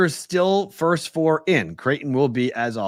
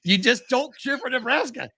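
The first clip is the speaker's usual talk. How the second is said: high-pitched exasperated retort; exasperated self in argument; 10 semitones higher than usual. Retold or imitated. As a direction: argue back in a raised pitch. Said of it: "we just added four characters to the show".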